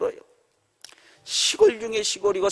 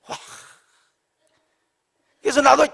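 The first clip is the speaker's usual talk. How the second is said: usual speaking voice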